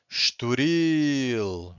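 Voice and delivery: "estoril" with a very long "ril"; stress on final syllable. Shown as a demonstration. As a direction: hold the "ril" in "estoril", slow and drawn out. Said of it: "so we stress the final syllable".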